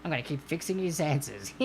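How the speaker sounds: low, sneaky little snigger; sniggering computer gremlin. Pretend. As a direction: snicker like a mischievous guy inside the computer. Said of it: "guy inside the computer going".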